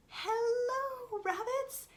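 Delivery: high, drawn-out hello; mouse greeting. Acted. Mouse greets family of bunny rabbits, high hello. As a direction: call out in a high little voice.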